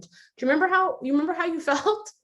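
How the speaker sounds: high, lightly acted prodding question; nagging reminder voice. Voicing the nagging loop reminder.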